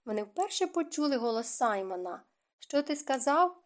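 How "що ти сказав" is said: ordinary delivery, nothing marked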